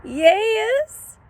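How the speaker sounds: high, small timid dog voice; a high, timid sidekick pipe; Drover's voice. A narrator's reading. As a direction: squeak it out like a nervous little dog. Drover answers Hank, timid.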